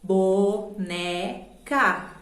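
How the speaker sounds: "Boneca" very slowly as bo, ne, ca; "Boneca" in three separate, stretched beats; syllable by syllable. Shown as a demonstration. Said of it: "pra contar as sílabas é essa".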